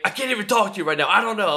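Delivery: high, rushed panicky quarterback; mock bugging out. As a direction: blurt it fast, imitating a rattled player. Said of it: "bugging out about shit".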